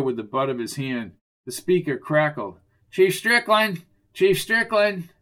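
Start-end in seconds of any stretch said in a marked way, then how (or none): 2.9-5.1 high, urgent call into a radio; high-pitched insistent summons. Acted. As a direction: hail your chief over the radio, pitched up. Calling the chief by radio.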